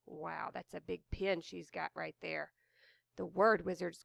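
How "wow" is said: ordinary delivery, nothing marked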